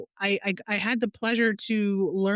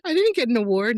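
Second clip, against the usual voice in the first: higher, louder laughing disbelief; baffled protest; 5 semitones higher, 4 dB louder than usual. Retold or imitated. Replying to mom's health worker's congratulations, baffled. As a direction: act out amused bafflement.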